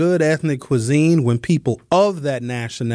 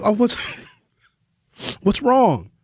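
retold concern; 7 semitones higher than usual. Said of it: "I'm like".

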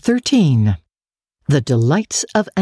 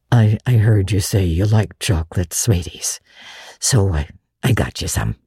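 put-on lower, halting bashful old man; shy Matthew; 6 semitones lower than usual. Matthew shyly giving Anne chocolates, lower and halting. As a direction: mumble it out sheepishly.

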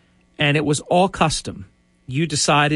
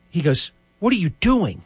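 gasp, then What are you doing pitched higher; imitating a shocked gasp; 6 semitones higher than usual. The boss catching sight of the initials, a mock-shocked gasp. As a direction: mimic the boss's startled gasp at the cuff. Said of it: "he gasped".

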